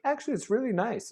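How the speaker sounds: high, sing-song imitation of a smug friend; a hint of smug mimicry; mock smug friend. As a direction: lightly mimic their self-satisfied tone. Retelling others' smug claim.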